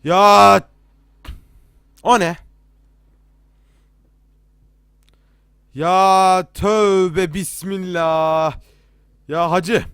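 loud, high cries of alarm; real jump-scare outburst; frightened shouting. Real, not acted. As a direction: yell in fright, stretching the words out. Jump scare in horror game.

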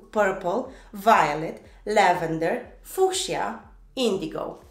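each English word set apart, emphatic swinging tune; repeat-along pronunciation drill. Shown as a demonstration. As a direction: lead learners through a colour list, word by word. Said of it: "pronunți totul odată cu mine". (model de pronunție, rostit apăsat, cu pauze)

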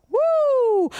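high loud woo; sharp high shout of praise; ecstatic whoop. Real, not acted. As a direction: whoop with holy excitement. Preacher overcome with fervour.

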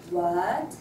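"what" starting low and sweeping up; rising tone. Shown as a demonstration. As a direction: let "what" climb like a question. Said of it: "it's a rising tone".

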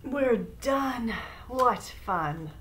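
ordinary delivery, nothing marked

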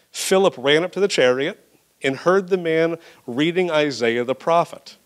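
ordinary delivery

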